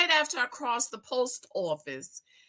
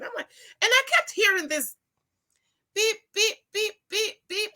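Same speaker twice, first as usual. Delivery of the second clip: imitating a beep; 10 semitones higher than usual. Imitating car warning beep.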